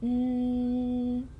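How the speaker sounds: N as a long, level nasal hum; letter sound. Shown as a demonstration. Teacher demonstrates the new letter, letter sound. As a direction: hold N as a continuous nnn, not the letter name. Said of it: "Nose noise".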